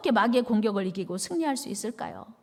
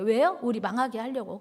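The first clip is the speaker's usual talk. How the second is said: normal talking voice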